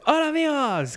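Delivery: high-pitched cartoonish hello; dubbed sitcom voice. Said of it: "que dice".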